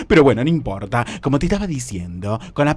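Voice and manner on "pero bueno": ordinary talk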